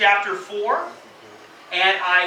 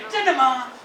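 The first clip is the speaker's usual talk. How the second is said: surprised; 6 semitones higher than usual. Shown as a demonstration. Chinese version of the surprise.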